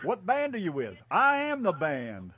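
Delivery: hammed-up band-star boast; mock self-important. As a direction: act out a question, then a slow grand reply. Acts out question and boastful reply.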